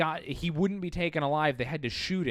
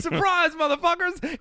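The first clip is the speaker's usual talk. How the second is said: high, louder mock-villain shout of triumph; gleeful shout; 13 semitones higher, 6 dB louder than usual. Trapper popping up from the basement at the Mounties, gleeful villain shout. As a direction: burst out of hiding with a gleeful yell.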